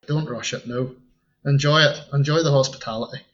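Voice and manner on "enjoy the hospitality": usual speaking voice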